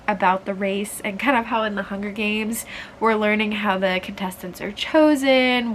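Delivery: ordinary delivery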